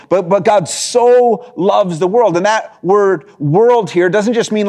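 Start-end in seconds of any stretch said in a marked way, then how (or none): 0.3-2.4 slow and emphatic on "so"; stress on so loves. Shown as a demonstration. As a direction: press "so" firmly and stretch it. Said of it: "Not, not, not just love the world".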